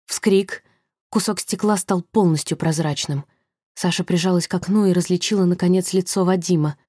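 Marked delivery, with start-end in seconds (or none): none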